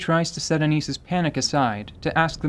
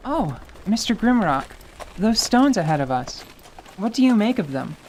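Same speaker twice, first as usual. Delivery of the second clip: put-on curious young man; 5 semitones higher than usual. Leon voiced, curious.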